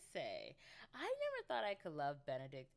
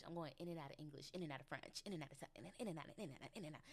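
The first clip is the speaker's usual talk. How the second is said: rapid-fire, running into a jumble; rushed comic repetition; 4 dB quieter, much faster than usual. Pretend. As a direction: speed up until the words blur together. Jokes about muddling her languages.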